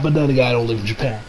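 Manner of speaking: normal talking voice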